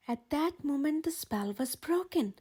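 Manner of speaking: ordinary delivery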